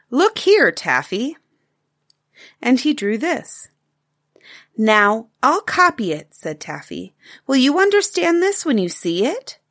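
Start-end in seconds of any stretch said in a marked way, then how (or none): 0.1-1.4 higher, louder look here; lightly voiced father. Father calling. 4.8-9.6 lightly voiced excited little girl, higher; a bright child's eager question; a hint of a young girl's voice; little girl Taffy.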